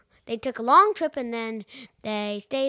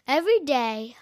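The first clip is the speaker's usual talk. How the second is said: usual speaking voice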